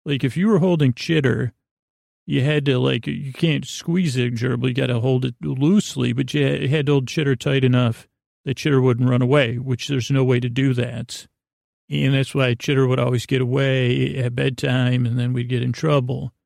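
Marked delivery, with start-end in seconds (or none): none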